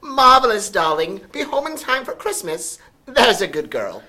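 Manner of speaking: high, loud gushing mother voice. A narrator's reading. Mother sending Sophie off, high and gushing. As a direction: gush it out like a delighted society mother. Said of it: "said her mother".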